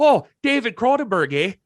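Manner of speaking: put-on high-pitched failed Canadian accent, sounding Irish; badly faked Canadian lilt, way up high; still-Irish Canadian accent. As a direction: try Canadian, drift into Irish. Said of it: "No, that's still Irish".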